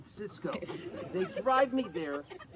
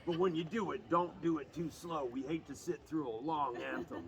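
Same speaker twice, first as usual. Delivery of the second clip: low Bochy voice; 3 semitones lower than usual. Retold or imitated. Bochy grabs the singer, says it low. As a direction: lean in low as the gruff skipper. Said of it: "And he goes".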